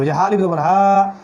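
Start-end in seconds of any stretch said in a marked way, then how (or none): 0.1-0.2 হা with a long, held "aa"; drawn-out vowel. Shown as a demonstration. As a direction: stretch out the last হা. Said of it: "আবার টান দিতে হবে".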